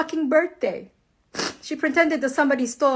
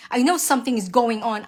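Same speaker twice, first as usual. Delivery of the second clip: usual speaking voice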